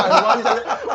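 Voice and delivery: retold helpless amusement; amused laughter. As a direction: repeat it while cracking up. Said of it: "मेरे को हंसी आ रही है".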